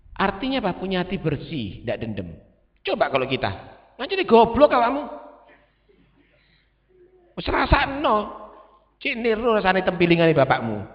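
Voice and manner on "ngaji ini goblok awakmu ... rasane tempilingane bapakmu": higher, louder mock scold in Javanese; mock angry scolding. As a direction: bark at the child like an annoyed parent. Parent scolding child in Javanese.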